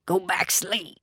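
choked, tight, strained; strangled voice. A narrator's reading. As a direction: force it through a tight throat. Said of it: "in a kind of strangled voice".

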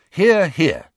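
loud, high hearty approval; enthusiastic. A narrator's reading. Boys backing their godfather's view, enthusiastic. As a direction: cheer the idea on loudly. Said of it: "said Harry and Ron enthusiastically".

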